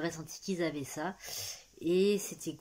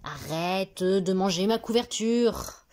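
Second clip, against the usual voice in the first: ordre fort et lent; réprimande au lapin; 3 semitones higher, 9 dB louder, slower than usual. Real, not acted. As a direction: lance l'ordre au lapin, fort et en traînant. Gronde le lapin qui mange. (reproche fort et lent au lapin qui mange la couverture)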